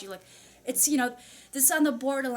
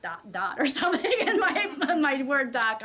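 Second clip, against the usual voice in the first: laughing; 2 semitones higher, 5 dB louder than usual. Real, not acted. Describing her Word doc notes to viewers, laughing. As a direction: let the laugh burst through the words.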